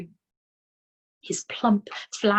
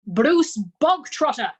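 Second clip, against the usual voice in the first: loud, slow barked name, higher; 6 semitones higher, 8 dB louder, slower than usual. A narrator's reading. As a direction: bark the name across the hall. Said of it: "the Trunchbull barked suddenly".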